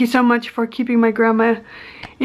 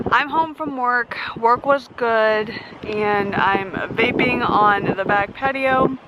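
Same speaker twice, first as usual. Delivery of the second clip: ordinary delivery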